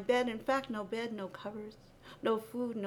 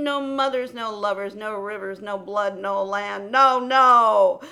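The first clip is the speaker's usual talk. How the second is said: loud refrain; 11 dB louder than usual. Acted. Poet chanting the refrain of loss, building loud.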